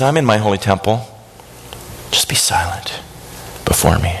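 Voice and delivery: low, hushed command, dropping to a near-whisper; quiet the room as a calm God. Acted. God answers worked-up Habakkuk, calm and hushed. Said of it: "Do you see the contrast?".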